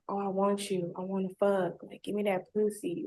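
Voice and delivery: soft, low imitated come-on; lightly mocked sleazy murmur; mock ear whisper. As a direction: murmur it close like in her ear. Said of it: "in my ear at the same time saying".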